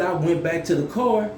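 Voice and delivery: normal talking voice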